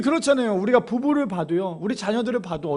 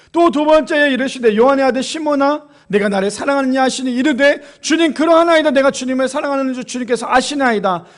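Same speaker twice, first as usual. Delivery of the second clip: loud raised scripture reading; fervent preaching; 5 semitones higher, 7 dB louder than usual. Acted. Pastor reads John to the congregation, loud fervent preaching. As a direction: proclaim the dialogue from the pulpit.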